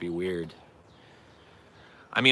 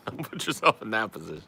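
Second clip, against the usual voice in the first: amused, laughing delivery; 2 semitones higher than usual. Real, not acted. Repeating a warning to a chat viewer, laughing.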